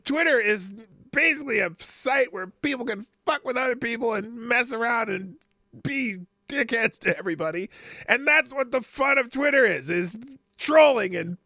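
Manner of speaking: loud, high-pitched ranting; worked-up rant. Real, not acted. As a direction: get fired up and rant. Continued rant.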